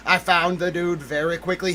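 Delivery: ordinary talk, nothing marked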